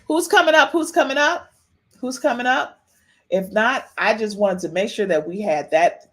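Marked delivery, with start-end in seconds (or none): 0.1-2.8 high, sing-out call for the next guest. Real, not acted. Host asks who is coming up next, calling out. Host calls for callers to join.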